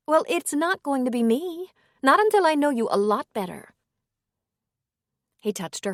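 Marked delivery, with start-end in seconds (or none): 0.1-3.7 higher, hesitant woman's voice. A narrator's reading. Woman gently declining the priest's invitation, higher voice.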